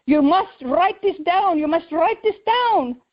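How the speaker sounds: high, insistent imitation of a Ugandan accent. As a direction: urge it slowly in a mimicked Ugandan accent. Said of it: "he sure does have a funny accent".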